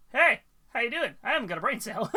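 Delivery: loud, high goofy voice; dopey cat voice. Pretend. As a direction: play it dim and cheery. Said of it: "This is the face of".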